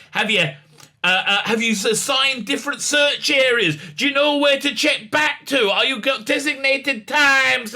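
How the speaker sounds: high-pitched badgering questions; mock a fussy lecturer firing questions; annoying lecturing. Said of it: "Yaz is just being annoying and lecturing".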